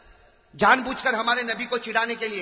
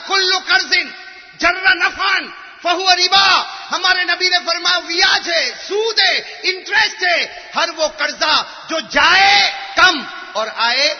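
loud, ringing sermon voice with drawn-out Arabic; fiery preaching; 7 semitones higher, 6 dB louder, slower than usual. Acted. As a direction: preach it to the whole mosque. Quoting hadith on interest. (پرجوش خطبہ، بلند آواز میں)

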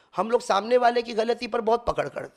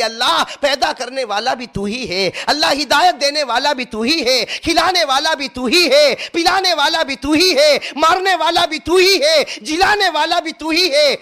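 pleading that climbs higher and louder; tearful pleading prayer; 6 semitones higher, 4 dB louder than usual. Acted. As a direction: build the prayer line by line. Saint's dua, building.

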